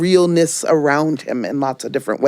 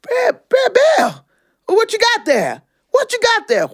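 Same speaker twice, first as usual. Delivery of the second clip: much higher, slow rabbit voice; high squeaky rabbit. Acted. Brer Rabbit calling out to Brer Bear, high. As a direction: pipe up high and eager.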